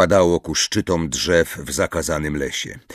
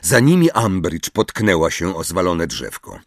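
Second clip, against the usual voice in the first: ordinary delivery, nothing marked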